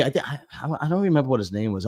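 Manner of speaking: usual speaking voice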